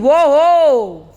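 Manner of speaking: loud excited double whoa; amazed whoa. Real, not acted. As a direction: cheer in disbelief. Amazed at forty seconds.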